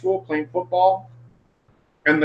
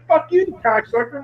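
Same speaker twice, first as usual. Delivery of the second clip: much higher mock outburst; indignant, pitched-up cursing; retold angry curse; 9 semitones higher than usual. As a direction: reenact swearing at the coach. Retold cursing at his coach.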